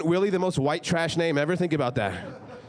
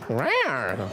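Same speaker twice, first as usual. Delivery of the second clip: mock cat growl; 4 semitones lower than usual. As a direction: lower playful rawr back at the crowd. Reply to a crowd meow.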